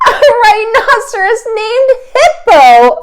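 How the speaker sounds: high, loud, slow laughing exclaim; high, slow laughter-filled shout; laughing cry. Acted. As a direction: crow it out through laughter. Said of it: "Fluffy cried".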